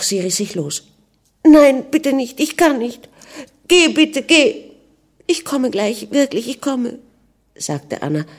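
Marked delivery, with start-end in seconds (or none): 1.4-4.6 high, loud, urgent begging; distressed pleading. A narrator's reading. Anna tears free of her husband, pleading in distress. 5.3-7.0 high-pitched, fragile promise at an ordinary volume; tearful assurance. A narrator's reading. Upset Anna sends her husband off, tearfully promising.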